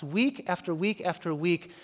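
normal talking voice

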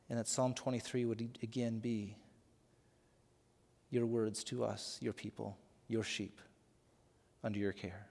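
hushed prayer voice for the congregation. Acted. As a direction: pray it quietly and humbly. Pastor leads congregation in prayer.

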